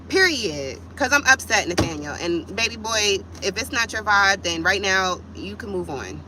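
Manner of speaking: ordinary delivery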